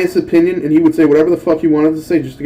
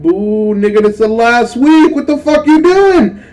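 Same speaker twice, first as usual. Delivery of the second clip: rising to a high, louder protest; retold protest; 7 semitones higher, 4 dB louder, slower than usual. To the professor about last-week work, rising exasperated yell. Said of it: "I'm like".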